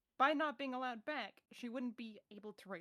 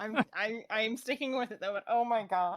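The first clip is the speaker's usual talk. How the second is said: laughing loudly; 11 dB louder than usual. Real, not acted. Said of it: "I'm glad you acknowledge it's terrible, 'cause I'm gonna say that laugh".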